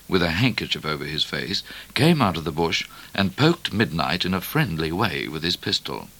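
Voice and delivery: plain reading voice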